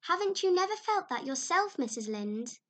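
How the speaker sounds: put-on excited girl. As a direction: gush like an imaginative child. Anne explaining, excited.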